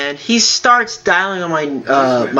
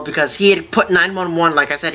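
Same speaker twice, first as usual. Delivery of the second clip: ordinary talk, nothing marked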